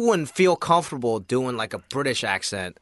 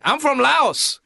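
Laotian neighbor; 10 semitones higher, 3 dB louder, much slower than usual. Retold or imitated. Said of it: "And he's like".

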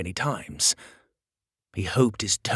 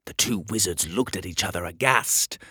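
ordinary delivery, nothing marked

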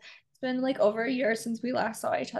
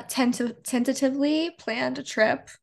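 usual speaking voice

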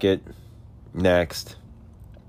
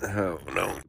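whispered gasp while choking; barely voiced, still choking on smoke; 8 dB quieter than usual. Real, not acted. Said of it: "Gosh, I'm, like, choking".

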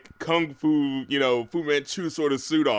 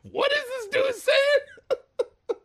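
slow, high, laughing mock-confused question; much slower than usual. Recalling the cartoon subtitles, slow high laughing bewilderment. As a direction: laugh out the puzzled quote. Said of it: "'cause I was like".

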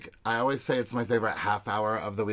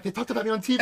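mock Kayla voice; 11 semitones higher, 4 dB louder, much faster than usual. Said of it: "Kayla's like".

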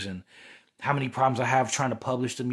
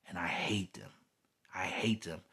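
low, much softer, dropping towards a whisper; hushed loathing; 5 semitones lower, 9 dB quieter than usual. Real, not acted. Confiding hatred of the demons attacking them, hushed and low. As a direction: say it under your breath, nearly whispered.

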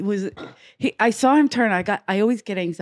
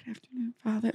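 barely audible mumbled good afternoon, much softer; mumbled greeting; 2 semitones higher, 12 dB quieter, faster than usual. Retold or imitated. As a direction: mumble it under your breath, anxious. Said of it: "I was doing it in my trauma head".

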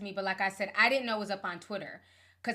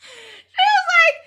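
laughing; 15 dB louder, much slower than usual. Real, not acted. Cracking up while retelling.

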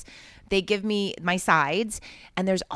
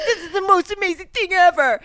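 high, loud gushing; over-the-top starstruck exclamation; thrilled; 11 semitones higher, 9 dB louder than usual. Retold or imitated. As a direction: re-enact pure fan excitement. Re-enacting her amazed reaction.

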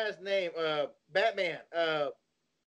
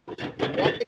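whispered; 4 semitones higher, 4 dB louder, slower than usual. Retold or imitated. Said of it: "repeating the question".

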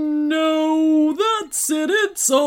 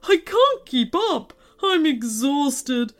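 high, put-on giant's lament; woeful lament; 4 semitones higher, 4 dB louder than usual. A narrator's reading. Tearful candy maker explains the theft, lamenting. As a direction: pour out the woes in despair.

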